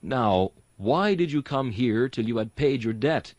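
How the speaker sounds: ordinary delivery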